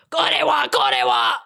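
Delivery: high, harsh cartoon-villain yell; loud hammed-up Japanese voice acting; Japanese anime shout. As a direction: imitate an over-the-top anime voice actor. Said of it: "change the tone of their voices for different lines".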